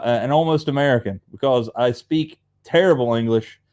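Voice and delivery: ordinary delivery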